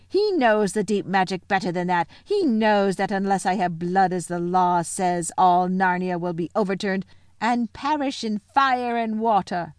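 imperious witch laying down the law; haughty villainess, ringing delivery; scornful witch. Acted. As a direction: declaim it with scorn and threat. Witch defies the bull.

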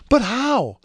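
high, surprised question; eager question. A narrator's reading. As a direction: ask it full of hope. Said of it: "asked Princess Maya".

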